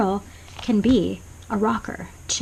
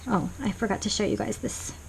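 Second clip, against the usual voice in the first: usual speaking voice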